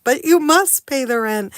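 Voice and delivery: booming stage villain; loud melodrama villain demanding rent; mustache twirling villain. Acted. Melodrama skit with a pen.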